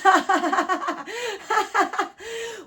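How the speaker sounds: loud, high giggling laugh; real high laugh; high burst of laughter. Real, not acted. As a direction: crack up at the card. Laughs at the reading.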